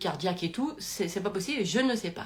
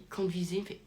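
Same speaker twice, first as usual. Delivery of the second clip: ordinary delivery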